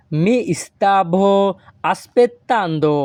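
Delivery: very slow, careful Italian sentence; slow Italian model. Shown as a demonstration. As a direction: spell it out slowly for learners. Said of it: "এই বাক্যের Italian ভাষা হবে".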